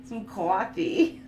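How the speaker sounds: drawn-out Boston "coffee"; slow, exaggerated Boston accent. Shown as a demonstration. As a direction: stretch the vowel in "coffee" like a Bostonian. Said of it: "like, a Boston accent?".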